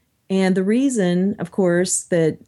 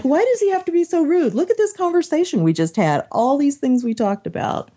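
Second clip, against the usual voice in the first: higher-pitched, protesting quote, easing down; imitated earnest appeal; 8 semitones higher than usual. Speaker voicing atheist commenters about Dawkins, mock protesting appeal. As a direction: mimic friends appealing for civility, starting high.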